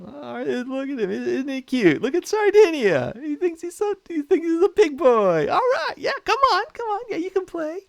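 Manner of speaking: put-on very high, patronizing baby talk; high sing-song talking down to a toddler; condescending cooing. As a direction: coo over a little kid like doting grown-ups. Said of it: "the French and British are going".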